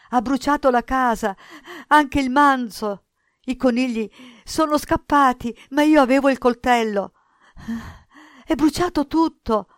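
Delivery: high, loud, frantic wailing tone; distraught boy repeating. A narrator's reading. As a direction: cry out each horror in panic. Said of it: "e ripeteva".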